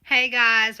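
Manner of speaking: ordinary talk, nothing marked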